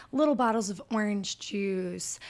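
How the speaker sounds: ordinary talk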